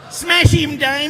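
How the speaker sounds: high, loud egging-on shout; mock yell. Presenter acts out cheering on Damo, high hammed-up yell. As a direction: holler it to a mate. Acted yell of weapon slogan.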